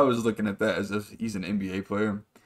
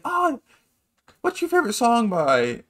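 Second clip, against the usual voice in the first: very high mock female voice; imitating her in falsetto; imitated woman; 12 semitones higher, 5 dB louder than usual. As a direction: put on a high, loud woman's voice. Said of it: "an attractive woman".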